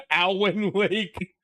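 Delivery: laughing. Real, not acted. Mangling the name, laughing.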